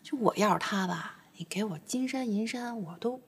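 soft unvoiced aside; whisper. Daughter confides to mother, whispering. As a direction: breathe the words out.

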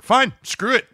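high, loud snapped quote; retold angry outburst. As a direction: fling it out like you're done arguing. Retold fight with wife.

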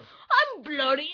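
slow, loud mock British; half-committed British kid accent; British boy accent. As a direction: start a hammed-up British boy line. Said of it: "You're doing great British voice".

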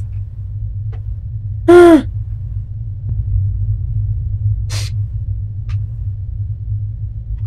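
long, high indrawn gasp; startled gasp. Real, not acted. Gamer spooked while exploring, gasping. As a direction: gasp sharply at a scare. Scare in horror game.

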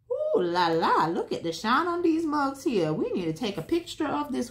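delighted admiring. Real, not acted. Admiring shiny drills.

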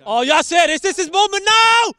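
high, loud rising anticipation; excited commentary. Real, not acted. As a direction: build up as if a goal is coming. Player about to shoot.

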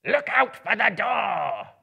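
high, loud, slow goblin bellow; bellowing captain. A narrator's reading. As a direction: bellow the command slowly. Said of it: "bellowed the captain".

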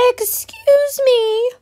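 high, slow hen call. A narrator's reading. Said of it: "she said".

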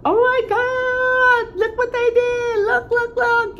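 very high, slow gushing squeal; excited squeal. Retold or imitated. Vlogger imitating her excitement at fans' Mother's Day news. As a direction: squeal with delight at what they did. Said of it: "I'll be all excited and I'll be like".

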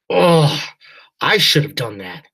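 loud, high pained cry; pained outburst. A narrator's reading. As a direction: blurt it out in pain. Father admits after punching wall.

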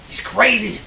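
high, loud accusing cry; alarmed yell. Acted. Brent cries out to the crowd about Dave, alarmed yell. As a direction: shout it to the onlookers.

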